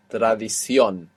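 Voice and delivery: "Tradición" with weight on the final CIÓN; stress on last O. Shown as a demonstration. Teacher showing the written accent, final syllable stressed in "Tradición". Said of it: "showing you that you're stressing it".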